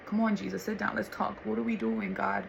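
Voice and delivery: soft, hushed talk to God; intimate midnight prayer. Retold or imitated. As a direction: pull up a chair with Jesus, quietly.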